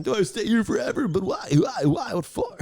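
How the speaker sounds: high, anguished why; despairing cries. Acted. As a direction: wail the questions in despair.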